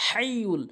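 হাইয়ুল with h from mid-throat. Shown as a demonstration. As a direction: squeeze the h of হাইয়ুল from the middle of the throat. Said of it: "হলকের মাঝখান থেকে".

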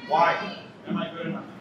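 usual speaking voice

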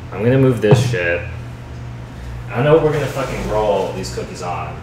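ordinary talk, nothing marked

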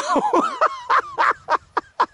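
very high squealing laughter. Real, not acted. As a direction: shriek with laughter. Friend's antics.

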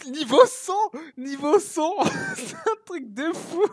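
high, loud cry breaking into a laugh; thrilled shout. Real, not acted. Streamer sees level one hundred, high excited shout with laugh. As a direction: yell it, then laugh in disbelief.

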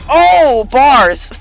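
high, loud drawn-out oh; hyped-up cheer. Real, not acted. Gamer celebrating his improvised rhyme, hyped-up cheer. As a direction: hype up your own rhyme.

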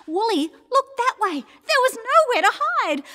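high, loud panicked storytelling; rising panic. A narrator's reading. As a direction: pitch it way up in alarm. Second look, rising panic.

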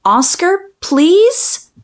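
usual speaking voice

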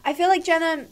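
usual speaking voice

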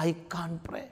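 breathy whisper of despair; whispered confession. Retold or imitated. The priest voicing the troubled young man, whispering.

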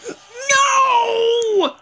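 startled high shriek; real scream. Real, not acted. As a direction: gasp then a high, loud scream. Jumpscare in horror game.

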